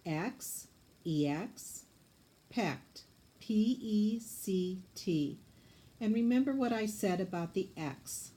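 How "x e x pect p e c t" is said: "X" then E X, "pect" then P E C T, slow letters; scooping syllables. Shown as a demonstration. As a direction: split "Expect" and spell each syllable. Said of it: "Scoop it for me".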